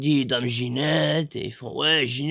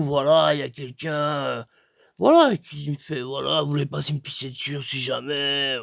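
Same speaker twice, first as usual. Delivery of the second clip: normal talking voice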